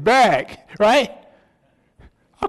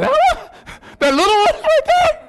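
very high, loud comic squeal of disbelief; hammed-up disbelief; 4 dB louder, slower than usual. Husband doubts wife's little brother played pro, mock incredulous squeal. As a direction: squeal it incredulously, breaking toward laughter. His incredulous reply to wife retold.

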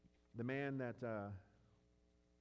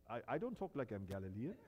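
put-on lower, disguised voice with a nervous laugh, faking a Galilean-free accent; put-on Galilean denial; 5 semitones lower than usual. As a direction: Peter hiding his Galilean accent, laughing it off. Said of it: "He's gonna change his accent immediately".

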